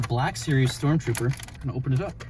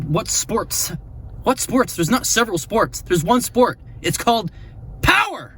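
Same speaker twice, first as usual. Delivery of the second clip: exasperated rant; 8 semitones higher than usual. Real, not acted. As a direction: build up to a shouted punchline. Ranting about the mistake.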